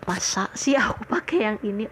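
hushed, disbelieving aside; retold private thought, whispered; doubting whisper. Her doubtful inner reaction, retold.

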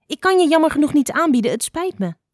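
high, loud and quick; rushed, high-pitched bird reply; hasty apology. A narrator's reading. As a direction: rattle it off, then drop on the apology. Hurried reply in the argument.

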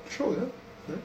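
high, soft mock sob; a hammed-up teary whimper; mock weeping. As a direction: imitate the crying crowd lightly. Mimics the weeping crowd.

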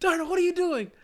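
high, loud hammed-up yell to stop play; mock coach yelling. Coach halting the fast break drill to yell at Darnell, high mock shout. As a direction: bark the whole drill to a halt, mock-furious. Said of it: "and I'm like".

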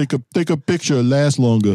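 high put-on Bensonhurst accent; mock a Brooklyn local's dialect. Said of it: "you sound like you're from Bensonhurst".

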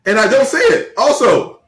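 high, loud incredulous comeback; indignant protest. Real, not acted. Protesting at being told to stop.

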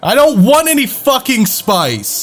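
exasperated. Acted. Player argues loudly in character.

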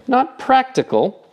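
indignant amazement. A narrator's reading. Stoit repeating the insult back, indignantly amazed. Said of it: "with indignant amazement".

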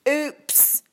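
high, fake-innocent oops; mock oops. Boy splashes Sophie on purpose, mock innocent. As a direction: pretend it was an accident.

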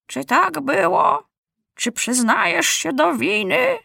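put-on high, drawn-out old judge voice; grey-haired judge general. A narrator's reading. Judge questioning the accused boy, ponderous and high. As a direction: question slowly, pitched high. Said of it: "zapytał się siwy sędzia generał".

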